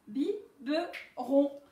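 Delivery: slow, drawn-out Biberon; syllable by syllable. Shown as a demonstration. Teacher to pupils, slow syllable count. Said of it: "trois syllabes".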